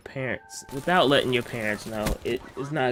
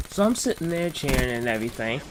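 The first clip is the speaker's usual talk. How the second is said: ordinary delivery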